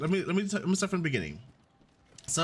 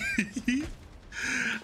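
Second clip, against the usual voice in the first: laughing; 8 semitones higher than usual. Real, not acted. Laughing after being scared.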